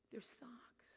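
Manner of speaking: barely audible, reluctant whisper; embarrassed whisper. Retold or imitated. Pastor has to repeat socks to woman, whispered. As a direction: own up under your breath.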